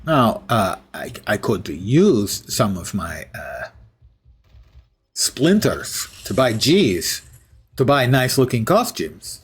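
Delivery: hammed-up Russian-accented English. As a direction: reason it out in mock Russian English. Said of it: "I'm sorry about the Russian accent".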